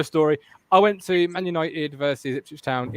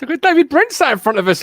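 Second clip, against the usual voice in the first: pitched up, fast mocking jibe; excited teasing; 9 semitones higher, 3 dB louder, faster than usual. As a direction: rib him with a quick high jibe.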